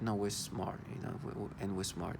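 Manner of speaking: soft, smug mimicry of their boasting; lightly mimicked smug boast; mocking smug tone. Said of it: "they say that like that".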